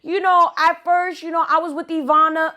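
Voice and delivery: high, innocent voice making excuses; high mock-innocent excuses; mock innocent girl. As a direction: play the sweet little liar. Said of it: "And she's like".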